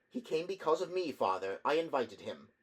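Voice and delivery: put-on high, urgent young woman; young daughter voice. A narrator's reading. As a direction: plead with father. Daughter intervenes to protect him.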